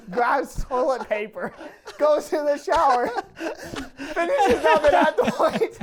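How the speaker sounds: can barely get the words out for laughing; laughing while telling. Real, not acted. Telling friends the shower story, laughing hard.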